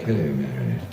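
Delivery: very low, soft posh English hello. Shown as a demonstration. Showing the character's other register, deep soft greeting. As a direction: drop right down low and quiet. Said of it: "lower it in my voice".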